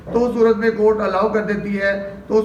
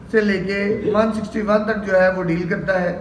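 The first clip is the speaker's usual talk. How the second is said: usual speaking voice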